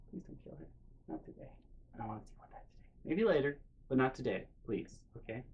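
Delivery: very soft, near-whispered begging; hushed pleading. Retold or imitated. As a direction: quietly plead someone out of violence. Begging friend not to kill.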